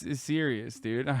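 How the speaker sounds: ordinary talk, nothing marked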